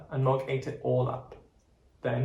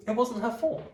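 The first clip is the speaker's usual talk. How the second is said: put-on child voice; 10 semitones higher than usual. Debbie voiced defending Mog.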